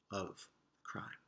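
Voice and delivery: breathy secret whisper; dramatic detective whisper. Acted. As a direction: hush it like a detective. Whisper at scene of crime.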